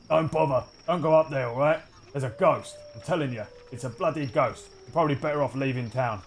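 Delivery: a lightly voiced townsman, fearful; townsman warning. Larger man warning Alvin about the ghost, uneasy. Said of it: "another voice saying".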